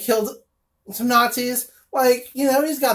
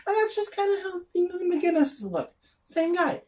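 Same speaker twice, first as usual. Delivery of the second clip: higher, softer, with a sudden odd lilt; 8 semitones higher, 4 dB quieter than usual. Real, not acted. Reviewer slipping into a higher, softer voice mid-comic review. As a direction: slip into a higher voice and let it go soft. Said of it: "don't know why I'm talking quite like this".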